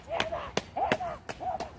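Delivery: repeated very high squealed calls; imitated sister calling out. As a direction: mimic a frantic sister calling across the rink. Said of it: "You can hear her going".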